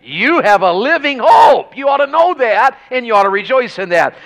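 loud, high preacher's call; fiery preaching. Acted. Preacher rousing the church, loud and high. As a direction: preach it out to the congregation.

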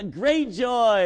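great joy shouted out loud, high and slow; excited. Shown as a demonstration. Showing the church real joy, loud and drawn out. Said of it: "It's like, get excited about what God has done".

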